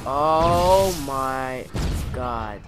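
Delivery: loud startled oh my god; alarmed shout. Real, not acted. Gamer caught off guard in the game, loud startled shout. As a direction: cry out as something goes wrong.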